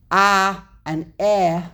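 slow, separated "A" and "E"; "A" and "E" as isolated vowel sounds; vowel sounds demonstrated. Shown as a demonstration. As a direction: sound out "A" as open ah and "E" as short eh. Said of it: "An E is a shorter form".

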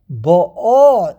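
bo'O with the final O swelling loud; short Bo, then a long stressed 'o; stress on second syllable. Shown as a demonstration. Teacher repeating Rashi's example, second syllable stressed in Bo'O. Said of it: "the emphasis is on the second syllable".

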